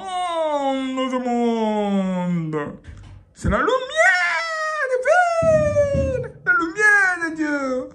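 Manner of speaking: high, stretched, awed cry; hammed-up holy vision; mock rapture. Mock divine revelation.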